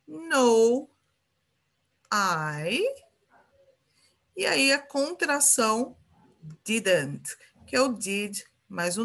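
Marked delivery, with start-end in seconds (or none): none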